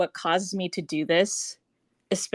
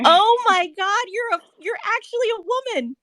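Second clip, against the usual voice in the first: much higher, astonished squeal of disbelief; shocked surprise; 13 semitones higher, 4 dB louder than usual. Real, not acted. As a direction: gasp it out, truly amazed she's a woman. Shocked host greeting guest.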